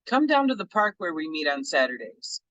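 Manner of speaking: ordinary talk, nothing marked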